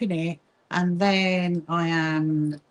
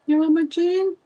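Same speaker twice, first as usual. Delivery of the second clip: cat talk; 10 semitones higher, 4 dB louder than usual. Real, not acted. Talking to her cat.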